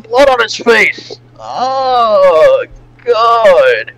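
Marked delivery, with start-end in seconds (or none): none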